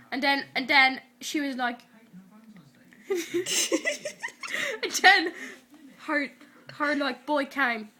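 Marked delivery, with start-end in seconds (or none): 3.1-4.8 real very high laugh; high squealing giggle. Real, not acted. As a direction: crack up in high-pitched giggles. Giggles in the middle of retelling.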